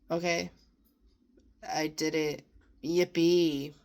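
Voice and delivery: flat, deflated little cheer; unenthusiastic yippee. Retold or imitated. To herself after a hard shower, a listless cheer. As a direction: celebrate without any joy at all. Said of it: "Not much enthusiasm for it".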